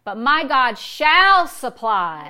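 SHALL stretched long and loud; slow, loud declaration peaking on "shall"; stress on SHALL. Shown as a demonstration. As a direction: hit "shall" hard and hold it. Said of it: "we're changing the emphasis on every word".

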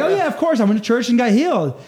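a light mock-casual believer; nonchalant locals. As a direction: say it like it's obvious. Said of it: "They're like".

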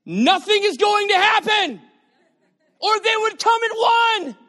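high, loud, full-force preaching; preaching shout. Real, not acted. Preacher shouting at parents who count, full force. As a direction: drive the point home shouting. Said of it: "Y'all are angry at me now".

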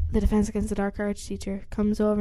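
usual speaking voice

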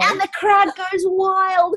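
high, slow mock crowd cheer; crowd goes wild. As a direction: hype it like a stadium announcer. Hosts mimic a crowd cheering.

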